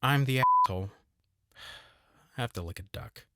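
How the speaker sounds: ordinary talk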